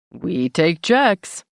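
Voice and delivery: deadpan, slow and dry; straight-faced. A narrator's reading. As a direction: say it dead serious. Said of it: "he said with a straight face".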